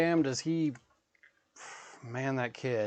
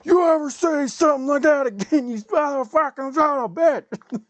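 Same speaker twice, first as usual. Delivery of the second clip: loud, high enraged roar; furious dad yelling; 9 dB louder than usual. Retold or imitated. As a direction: scream it at your son like an angry father. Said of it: "my dad ... pissed".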